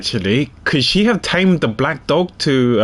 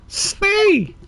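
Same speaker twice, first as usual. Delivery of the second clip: much higher, firmer nasal command; nasal animal tamer. Acted. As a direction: order the elephant to stop, high and insistent. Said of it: "let's give her a nasal one".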